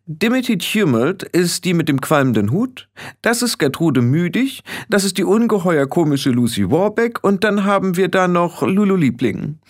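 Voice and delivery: put-on high-pitched, self-important girl; condescending and superior; haughty young witch leader. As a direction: look down on the newcomer, voice pitched high.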